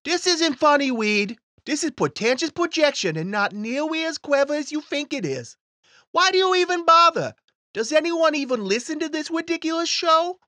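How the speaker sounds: put-on high whiny child voice; a sneering kid heckling; little boy voice. Timmy voice heckles the host.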